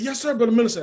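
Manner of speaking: eager reply. Retold or imitated. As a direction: respond keenly to a respected leader. Eager reply to minister retold.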